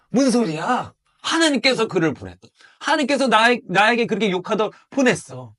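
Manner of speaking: high, loud David rebuking his soldier; acted stern rebuke. David answers the soldier who asked to behead Shimei, loud rebuke.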